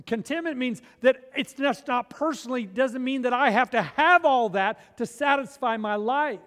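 loud, high, driving preacher voice; fired-up sermon peak; preaching at full force. Acted. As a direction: preach it hard to the congregation.

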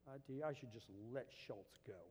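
put-on low, soft muttering of a grouchy old man; lightly voiced old grump grumbling to himself; hint of a cranky old man, muttered; grumpy old man mutter. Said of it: "And he goes".

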